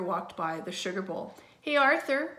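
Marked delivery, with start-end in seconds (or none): 1.6-2.3 high, loud hail; calling out. A narrator's reading. Friend calling to Arthur on his way, calling out. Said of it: "called Buster".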